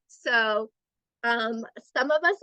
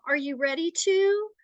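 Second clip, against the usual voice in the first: raised, questioning request; passive tone; 7 semitones higher than usual. Shown as a demonstration. Adult checking with a child, passive and questioning. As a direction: ask permission with a higher lilt. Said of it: "it sounds like a question".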